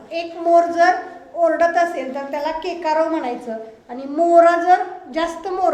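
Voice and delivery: usual speaking voice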